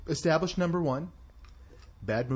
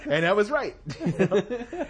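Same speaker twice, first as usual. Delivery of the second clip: high laughing through words; 6 semitones higher, 3 dB louder than usual. Real, not acted. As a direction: laugh while you talk. Laughing at own joke.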